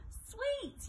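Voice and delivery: very high yelp. A narrator's reading. Greedy Graham spotting sweets, high yelp. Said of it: "yelped Greedy Graham".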